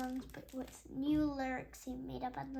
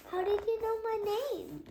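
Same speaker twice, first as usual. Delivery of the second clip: put-on surprised girl; 9 semitones higher, 7 dB louder than usual. Audrey asking surprised.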